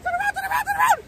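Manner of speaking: high, urgent repeated plea; panicked pleading. Real, not acted. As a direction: beg the boat to turn. Urging boat away from splash.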